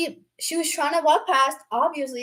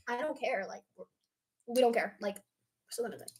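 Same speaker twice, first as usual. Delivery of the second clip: dismissive; 4 semitones lower, 14 dB quieter than usual. Retold or imitated. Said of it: "And they're like".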